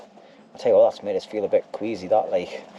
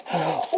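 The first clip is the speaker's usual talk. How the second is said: sharp gasp of pain; 6 semitones higher, 6 dB quieter than usual. Real, not acted. Iodine on a cut thumb.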